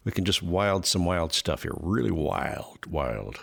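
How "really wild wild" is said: slow growl. Shown as a demonstration. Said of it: "fight sounds".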